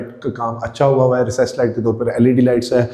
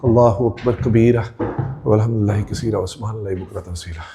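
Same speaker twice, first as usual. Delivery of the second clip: low recitation, fading softer; murmured dhikr; 3 semitones lower, 5 dB quieter than usual. Real, not acted. Host climbing to the first floor, murmured dhikr to himself. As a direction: recite Arabic praise under your breath, trailing down.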